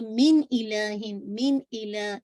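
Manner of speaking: من الہ slow, ن sounded cleanly before ا; clear izhar. Shown as a demonstration. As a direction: say من الہ with a clear ن, no nasal hold. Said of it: "اظہار حلقی".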